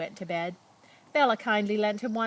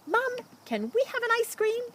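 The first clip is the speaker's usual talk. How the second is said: put-on child asking. Child begging mother for ice cream.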